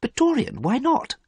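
very high-pitched astonishment; baffled, high-voiced protest; very surprised. A narrator's reading. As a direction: question him in disbelief. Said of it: "Basil was very surprised".

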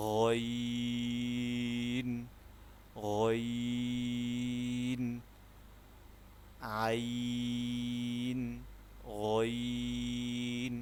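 গইন and আইন drawn out very long, গইন pitched a touch upward; Arabic ghain demo. Shown as a demonstration. Teacher contrasts গইন with আইন for students, slowly stretched. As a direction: hold each গইন slowly, letting the sound rise a little. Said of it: "যেমন".

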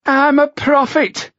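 high, slow agitated mimicry; mock her in a shrill prophet voice; John Hardy mocking the girl to the others. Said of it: "he mocked in a high, agitated voice".

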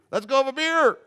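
high, loud mock party shout; rally your friends to go out; eager invitation. Voices worldly crowd's eager invitation.

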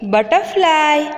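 teacher's high model બટરફ્લાય; English pronunciation demo. Shown as a demonstration. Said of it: "અંગ્રેજી ઉચ્ચાર થશે".

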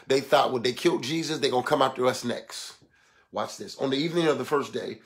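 low, soft sermon delivery; subdued scripture teaching. Real, not acted. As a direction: read and explain it quietly, reverently.

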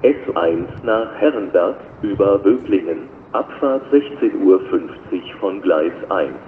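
ordinary talk

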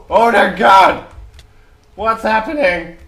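high, loud shocked cry; real alarmed surprise; panicked surprise. Real, not acted. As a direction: gasp it out in disbelief. Chaos in battle.

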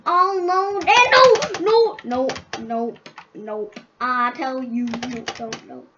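usual speaking voice

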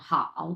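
low, dipping হাও in tone three; third-tone হাও. Shown as a demonstration. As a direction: let হাও sink low as a Mandarin third tone. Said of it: "তিন number tone".

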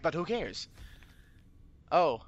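normal talking voice

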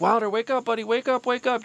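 high, desperate pleading shout; frantic yelling. Retold or imitated. Father yelling to wake his unconscious son, frantic high pleading. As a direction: yell to wake a limp child. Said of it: "yelling, like".

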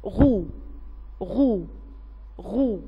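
three separate, evenly spaced "غو" syllables; letter sound demo. Shown as a demonstration. Teacher showing children how ghayn with damma sounds, demonstrating "غو". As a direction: say "غو" as ghayn with a short u. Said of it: "يعني صوته هنطقه ازاي؟".

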